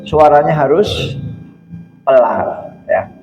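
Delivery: normal talking voice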